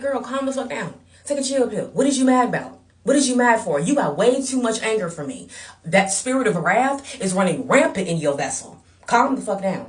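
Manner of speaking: high, loud telling-off; acted-out exasperated dressing-down; heated scolding. Acted scolding retort to hater.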